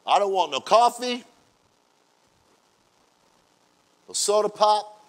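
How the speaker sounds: loud, emphatic pastor laying down the rule; Pastor Chris's rule. Retold or imitated. Pastor warning his congregation, loud and emphatic. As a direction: boom it out like the strict pastor. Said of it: "Pastor Chris said".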